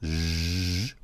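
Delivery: zh held as a buzzing sound by itself; isolated consonant. Shown as a demonstration. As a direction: sustain just the zh buzz. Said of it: "as in the word judge".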